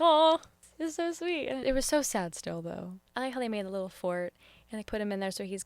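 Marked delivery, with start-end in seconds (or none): 0.0-1.5 touched aw. Retold or imitated. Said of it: "I was like".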